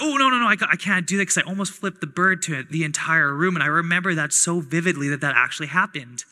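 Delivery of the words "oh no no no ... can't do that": sudden alarm. Retold or imitated. Almost flipping the bird.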